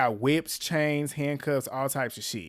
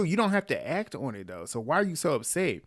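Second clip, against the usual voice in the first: ordinary talk, nothing marked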